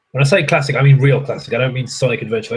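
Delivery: normal talking voice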